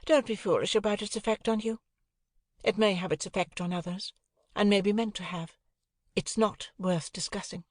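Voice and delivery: put-on cool, airy society girl; Estella. Estella voiced, higher.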